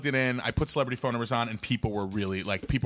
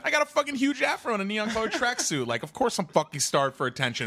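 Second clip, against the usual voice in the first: high mocking retort; 9 semitones higher than usual. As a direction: throw it back at her, mocking and high. He mocks back, stoned.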